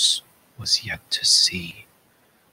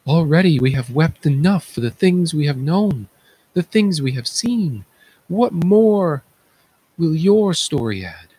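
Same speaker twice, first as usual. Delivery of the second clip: higher, mournful chorus voice; grieving chorus elder; 8 semitones higher, 4 dB louder than usual. Acted. Chorus elder answering the messenger in mournful grief. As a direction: lament it as the weary chorus.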